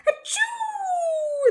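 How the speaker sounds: a sudden high-pitched sneeze; big high sneeze. Acted. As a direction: explode into a squeaky sneeze. Gerald sneezes in story.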